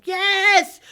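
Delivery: high, loud mock crowd cheer; crowd shouting. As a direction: yell it like a rowdy student crowd. Crowd shouting back at him.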